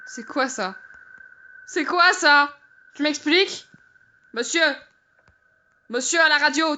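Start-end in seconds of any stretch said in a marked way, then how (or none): 1.6-2.6 higher, insistent repeat; insistent question. Real, not acted. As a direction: demand to know, pitched up.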